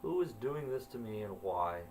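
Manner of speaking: ordinary delivery, nothing marked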